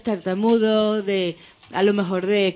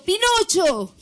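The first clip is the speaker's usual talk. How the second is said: put-on old man's punchline popped up much higher; spring the punchline in a high old man voice; 9 semitones higher, slower than usual. Old man answering Jesus, punchline thrown high. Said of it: "Y el viejito".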